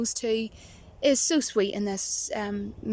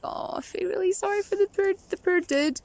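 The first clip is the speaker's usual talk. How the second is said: high, pitying mock sympathy; 9 semitones higher than usual. Viewer pitying the poor dude, high mock pity. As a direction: coo it like you pity him.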